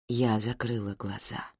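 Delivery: ordinary delivery, nothing marked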